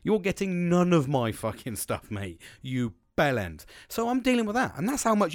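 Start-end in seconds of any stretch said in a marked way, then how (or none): 0.0-3.6 loud, high, drawn-out angry refusal; passionate outburst. Retold or imitated. Upset worker retelling his refusal of a meeting, passionate outburst. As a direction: stretch each word out loud with real indignation. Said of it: "you can feel the passion in my voice".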